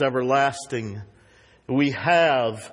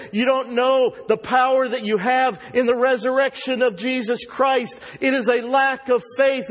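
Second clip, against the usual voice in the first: fervent preaching; 8 semitones higher than usual. Acted. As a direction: drive it home at full force. Preaching at full force.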